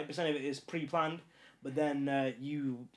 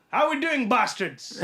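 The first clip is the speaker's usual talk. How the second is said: high, loud comic accent greeting; classic put-on accent; 8 semitones higher, 9 dB louder, much slower than usual. Shown as a demonstration. Podcast guest showing off an accent, loud and hammed-up. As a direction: belt out a hammed-up accent, much higher. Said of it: "that's a classic accent".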